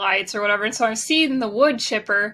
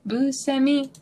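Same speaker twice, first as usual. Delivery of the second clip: imitating the app voice; 3 semitones higher than usual. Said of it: "this girl kinda sounds like me".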